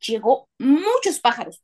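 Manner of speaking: usual speaking voice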